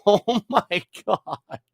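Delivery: laughing through the words; giggling disbelief. Retold or imitated. Reacting to fart story.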